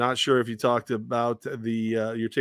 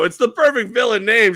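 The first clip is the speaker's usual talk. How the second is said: much higher and louder, gleeful; excited exclaim; 11 semitones higher, 8 dB louder than usual. Retold or imitated. Host retells telling the fan her name is perfect, much higher and gleeful. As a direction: proclaim it with glee. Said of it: "and I go".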